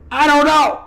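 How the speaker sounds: high, loud mock yell of defeat; frazzled squeal of cluelessness; exasperated outburst. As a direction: blurt it like your brain is fried. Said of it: "and I go".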